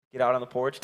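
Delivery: normal talking voice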